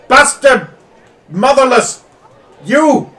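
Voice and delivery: high, loud shouted insults; furious insults. Acted. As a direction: spit each insult at him. Noble hurling insults.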